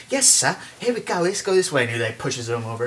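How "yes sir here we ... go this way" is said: high-pitched little helper voice; higher, louder put-on assistant voice. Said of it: "this little assistant's like".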